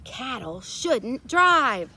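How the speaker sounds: mayor scolding. A narrator's reading. Mayor shaking his fist at the cows. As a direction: spell out each word crossly. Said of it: "The mayor shook his fist and said".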